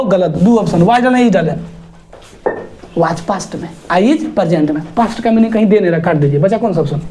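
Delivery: ordinary delivery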